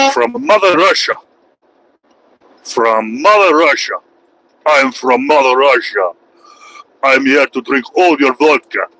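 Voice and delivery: mock Russian accent. Streamer playing a Russian for viewers, mock Russian accent. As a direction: boast like a hammy Russian.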